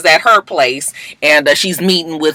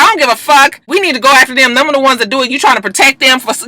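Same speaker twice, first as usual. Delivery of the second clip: angry accusation; 8 semitones higher, faster than usual. Retold or imitated. Laurel accusing Annalise angrily.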